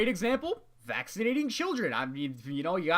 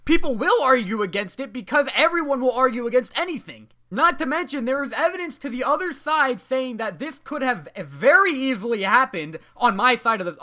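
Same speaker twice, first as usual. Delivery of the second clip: indignant rant; 4 semitones higher, 6 dB louder than usual. Real, not acted. Ranting about unfair grading.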